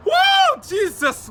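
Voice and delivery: very high, loud whoop; real excitement. Real, not acted. Vlogger raving about chicken and waffles, excited whoop. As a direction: whoop it out, overcome by delight.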